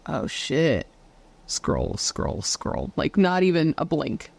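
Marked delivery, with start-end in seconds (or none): 0.1-2.9 low, toneless deadpan; barely react, voice dropping into a low croak; unimpressed doomscroller. Pretend. Us in bed scrolling past doom news, deadpan. Acts out unfazed scrolling on phones.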